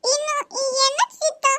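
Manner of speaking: put-on high, slow, tearful child's whine; a little girl sobbing for her uncle's attention; whiny child crying. Niece cries to her uncle to look at her.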